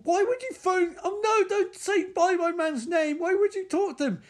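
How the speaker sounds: shrill falsetto mockery of the caller, a laugh in it; squeaky mock voice. Riffing on the movie, squeaks a mocking falsetto. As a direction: pitch it way up like a silly whiny woman.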